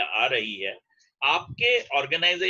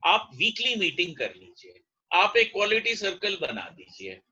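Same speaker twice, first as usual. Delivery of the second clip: usual speaking voice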